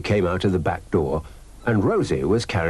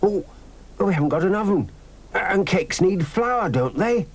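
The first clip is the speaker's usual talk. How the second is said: excited; 13 semitones higher than usual. A narrator's reading. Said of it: "Robin was very excited".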